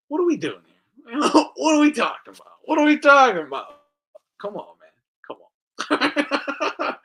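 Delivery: high, loud quoted outburst; Thunderdome quote. Retold or imitated. Speaker quoting Thunderdome to mock them, high and loud. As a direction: fling out the quote, half laughing. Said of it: "Thunderdome quote".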